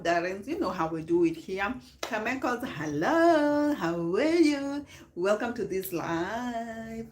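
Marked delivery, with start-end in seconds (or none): 2.0-4.8 high, loud, drawn-out hello; excited greeting. Real, not acted. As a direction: call out to a viewer. Live greeting viewers by name.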